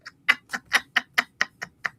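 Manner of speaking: long run of chuckles; real amused laughter. Real, not acted. Laughing at own family tree remark.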